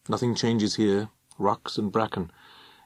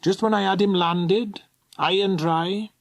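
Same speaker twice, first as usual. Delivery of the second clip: high, lilting voice of the aggrieved woman; put-on housekeeper voice; 9 semitones higher than usual. A narrator's reading. Old servant complaining about losing Bertram, high put-on voice.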